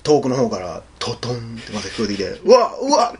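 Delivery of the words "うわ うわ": mock alarm. Acted alarm as monster returns.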